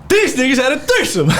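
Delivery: high-pitched, laughing disbelief; shocked, squealed exclamation; incredulous outburst. Real, not acted. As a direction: blurt it out way up high, cracking up. Shocked reveal of the threesome.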